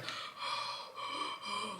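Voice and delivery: long unvoiced breath drawn in; mock deep breath. Recalling the breathing heard in the dark dorm, mock heavy breath. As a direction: imitate a man's heavy breathing. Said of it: "like a deep man breath".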